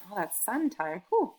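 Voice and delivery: ordinary talk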